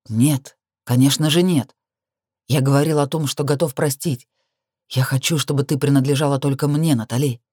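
lightly voiced man, low and firm; possessive male lover, insistent. Lover claims the heroine as his alone, low and insistent.

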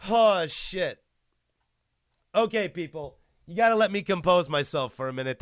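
usual speaking voice

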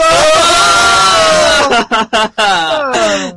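high, loud burst of laughter. Real, not acted.

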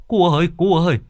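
put-on man begging a boy to hold back his dog; flustered panic. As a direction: stammer out a frightened plea. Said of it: "cuống cuồng".

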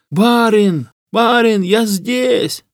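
high-pitched holler from afar; distant call. A narrator's reading. Trapped hunter calls up to his master, distant call. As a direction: cry out so rescuers can find you. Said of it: "Откуда донеслось".

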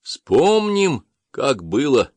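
high proclaiming call; preaching priest. Acted. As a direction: call the crowd to remember. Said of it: "повторил высокий жрец".

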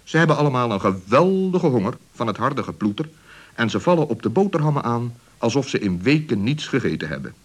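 usual speaking voice

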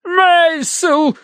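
very high drawn-out screech; shrieking wife. A narrator's reading. Hysterical wife calls for her husband, shrieking. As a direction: screech the name in full hysterics. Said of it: "верещала миссис Меринг".

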